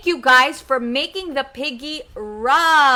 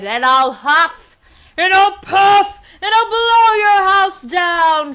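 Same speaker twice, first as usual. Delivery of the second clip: big bad wolf; 5 semitones higher, 7 dB louder than usual. Wolf threatens the two pigs, loud blustering threat. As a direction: boom out the threat, rising. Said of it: "the big bad wolf said".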